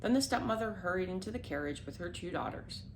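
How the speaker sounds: plain reading voice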